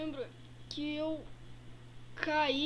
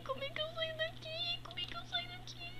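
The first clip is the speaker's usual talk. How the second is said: much higher, thin squeaky voice; 12 semitones higher, 8 dB quieter, faster than usual. Acted. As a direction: squeak the question up high. Repeats question in changed voice. (voz fininha e aguda)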